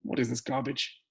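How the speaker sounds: mock the question with contempt; a taunting, scornful sneer. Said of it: "He is taunting you".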